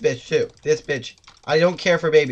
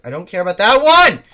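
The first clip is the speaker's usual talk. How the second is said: building to a loud, high yell; rising exasperated outburst; temper boiling over; 6 semitones higher, 11 dB louder than usual. Real, not acted. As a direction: let it climb into a shout. Losing temper at enemies.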